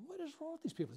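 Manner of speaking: stunned disbelief at the mourners; baffled outburst. Retold or imitated. Lifeguard at the drowning, baffled and high-pitched. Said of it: "And I'm going".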